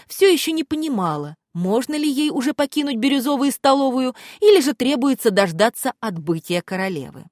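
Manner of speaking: plain reading voice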